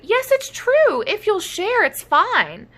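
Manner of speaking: eager. Acted. Dinos reassure.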